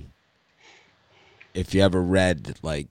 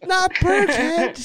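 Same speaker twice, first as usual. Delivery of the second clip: loud, drawn-out falsetto; mock panic; 5 dB louder, much slower than usual. Host mocking yet another player named, loud falsetto mock panic. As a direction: squeal it in hammed-up dread.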